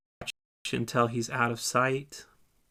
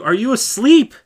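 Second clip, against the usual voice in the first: much higher and louder, near shout; loud wake-up call; 9 semitones higher, 12 dB louder than usual. Acted. Leader waking disciple a second time, loud call. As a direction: jolt the sleeper awake.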